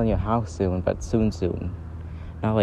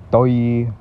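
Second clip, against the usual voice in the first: drawn-out doi, as if it's obvious; mock obviously. Shown as a demonstration. Vlogger explaining the other word for obviously, mock scorn. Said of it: "Like, obviously".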